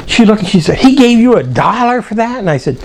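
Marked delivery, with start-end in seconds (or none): 0.8-2.4 higher, incredulous retold question; mock disbelieving mom. Mom to son about his pay, mock incredulous. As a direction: lift the pitch as an imitated mother who doubts it.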